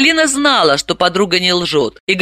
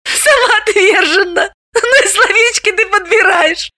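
snorting laughter; 14 semitones higher than usual. Acted. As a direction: burst out laughing at the word. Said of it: "Галина прыснула со смеху".

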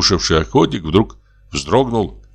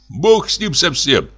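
high, pleading refusal; frightened refusal; 8 semitones higher than usual. A narrator's reading. Forester refuses to go out and help, frightened. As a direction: pitch it up, wanting no part of it.

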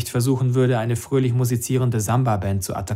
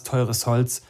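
ordinary delivery

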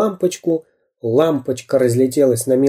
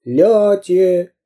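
raised, falling echo of a cry; drawn-out echo call; loud echo; 6 semitones higher than usual. A narrator's reading. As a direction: throw the tail of the word back. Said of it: "громко повторило эхо".

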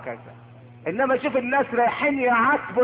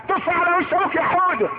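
egging-on parent; 8 semitones higher than usual. Acted. Parent tells Houda to spit at his father, egging on. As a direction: urge the little one on, pitched high.

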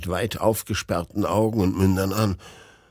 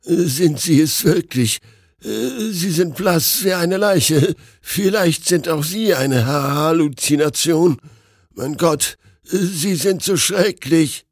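higher, louder, slow panicked voice; terrified doctor; 7 semitones higher, 4 dB louder, slower than usual. A narrator's reading. Doctor panicking at his pale friend on the stairs. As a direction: stammer out your fear.

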